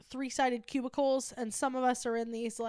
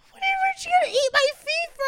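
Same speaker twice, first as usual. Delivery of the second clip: squeaky fast coworker; 7 dB louder, much faster than usual. Retold or imitated. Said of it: "And she's like".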